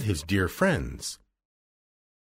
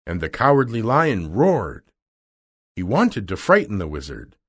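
usual speaking voice

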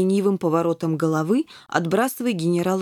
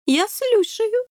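slow, much higher drawn-out king; slow drawl; 13 semitones higher, slower than usual. A narrator's reading. King drawls to Boom and the kitten, slowly. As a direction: stretch it out, lazy and royal. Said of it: "медленно протянул король".

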